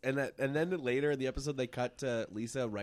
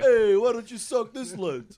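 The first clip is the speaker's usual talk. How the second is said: loud, high heckler comeback; stand-up comic voice; 9 semitones higher, 8 dB louder than usual. Pretend. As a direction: fire it back at the heckler.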